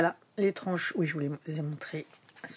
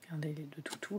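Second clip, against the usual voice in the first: hushed whisper over the cards; 11 dB quieter, faster than usual. Real, not acted. Vlogger showing tarot cards to her viewers, whispering. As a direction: breathe it out softly while showing a card.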